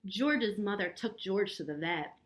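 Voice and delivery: ordinary delivery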